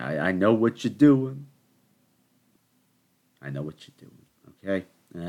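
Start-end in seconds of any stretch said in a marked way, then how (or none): 0.0-1.5 louder, pointed call-out; mock accusation. Telling viewers he has caught them, loudly. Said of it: "to viewers". 3.4-4.3 softer, lowered repeat; hushed warning. Acted. To the viewers, quietly and knowingly. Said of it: "repeats to viewers".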